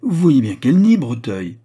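loud, high, jubilant outburst; explosion of hope. A narrator's reading. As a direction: seize on it with sudden wild hope. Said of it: "avec une explosion d'espoir".

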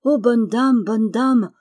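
tearful plea. A narrator's reading. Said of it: "d'un accent larmoyant".

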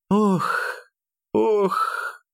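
high breathy dying moans; pained moans. A narrator's reading. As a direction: moan out in pain, gasping. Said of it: "Простонала Евдокия тяжело и часто дыша".

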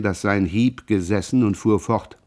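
ordinary delivery

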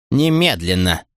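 insistent one-word order; firm command. A narrator's reading. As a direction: demand it at once, without shouting. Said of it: "твёрдо приказала она". (твёрдый приказ)

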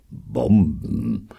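drawn-out, falling scornful word; contemptuous. A narrator's reading. Sargnagel dismisses the plan to bomb wolves, contemptuous. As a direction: let it slip out with disdain. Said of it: "entfuhr es ihm verächtlich".

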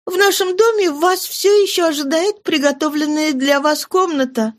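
put-on high young girlish voice, hurt and scolding; a daughter chiding her father for leaving; Cosette's reproach. A narrator's reading. Cosette scolds her returned father, high, reproachful.

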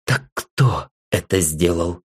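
plain reading voice

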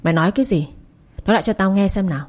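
plain reading voice

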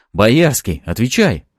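loud, angry challenge; angry demand. A narrator's reading. Fyodor grabs his pistol and confronts Boyarsky, angry demand. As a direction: demand an answer with force.

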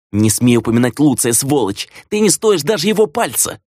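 high-pitched outraged rebuke; furious yelling. A narrator's reading. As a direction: shriek of indignation without full volume. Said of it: "Теперь уже орала она".

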